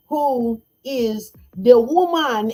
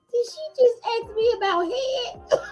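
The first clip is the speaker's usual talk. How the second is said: high, shocked disbelief; in stunned disbelief; 11 semitones higher, 4 dB quieter than usual. Retold or imitated. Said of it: "his character in his head was like".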